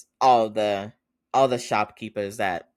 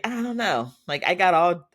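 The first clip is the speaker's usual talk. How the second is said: higher, louder mock protest; indignant brother; 9 semitones higher, 5 dB louder than usual. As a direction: play it put-out and a bit whiny. Brother protesting being sent away.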